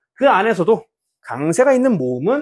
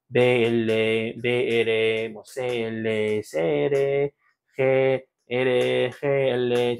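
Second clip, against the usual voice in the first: very slow, held syllables on a low level tone; drawn-out practice syllables; 5 semitones lower, 4 dB quieter, much slower than usual. Shown as a demonstration. Teacher drilling sounds for students to practice, drawn-out syllables. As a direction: stretch each syllable out evenly, like a drill. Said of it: "쭉, 쭉, 쭉, 쭉 연습을 하시면서".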